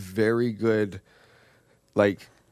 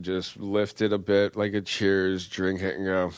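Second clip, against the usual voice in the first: normal talking voice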